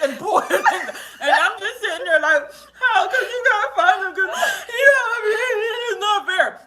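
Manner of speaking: high, hammed-up sobbing through laughter; laughing while pretending to cry; mock sobbing. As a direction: wail it like a fake cry.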